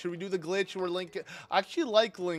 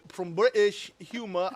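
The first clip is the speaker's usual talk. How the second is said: slow, exaggerated British accent, higher and louder; British accent attempt; 4 semitones higher, 7 dB louder than usual. Shown as a demonstration. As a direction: put on a bad British accent. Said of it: "I can't do an accent".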